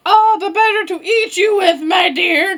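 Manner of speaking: high, mounting menace; angry wolf. Acted. Wolf about to eat Little Red, angry. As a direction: pitch it up and pounce on the words. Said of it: "he's very angry".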